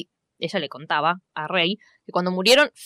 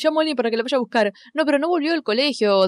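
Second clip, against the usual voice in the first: quick, higher put-on voice of a careless mother; imitating Rey's mother; 6 semitones higher than usual. Friend quoting Rey's mother on the phone to Molly, quick and higher. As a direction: rattle it off fast, mock-annoyed. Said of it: "La madre de Rey finalmente llama a Molly diciéndole".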